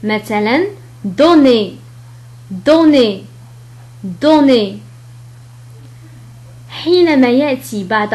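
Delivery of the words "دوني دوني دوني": "دوني" louder, with a clear French E ending; teacher modelling "دوني"; French word demo. Shown as a demonstration. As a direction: model "دوني" with the final sound crisp. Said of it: "كحرف إي".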